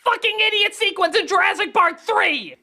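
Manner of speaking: ordinary delivery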